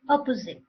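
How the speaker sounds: "Opposite" as one word, "uh-po-zit", falling; actual pronunciation. Shown as a demonstration. Teacher repeating the word for learners, actual pronunciation. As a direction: end "Opposite" on a clear t. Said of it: "the actual pronunciation of the word is".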